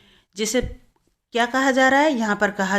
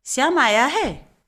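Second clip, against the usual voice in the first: mildly surprised, higher and drawn out; surprise; 6 semitones higher, 4 dB louder than usual. Shown as a demonstration. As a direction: read it as a slow exclamation of surprise. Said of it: "कुछ आश्चर्य का भाव प्रकट होता है".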